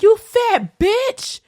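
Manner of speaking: drawn-out, high, loud insult; imitating a kid's loud cussing. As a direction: hurl it like a mouthy kid, stretched out. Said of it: "big ass kids cussing me out".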